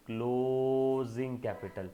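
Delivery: "closing" stretched very long; slow, sustained prompt to the class; drawn-out answer. Real, not acted. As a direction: hold the first vowel of "closing", then finish "capital".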